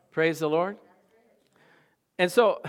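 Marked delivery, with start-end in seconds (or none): none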